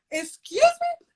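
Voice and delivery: very high, incredulous mock outrage; offended. Woman reacting to a toddler's insult, mock offended. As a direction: squeal it like you've been insulted. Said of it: "I was so offended".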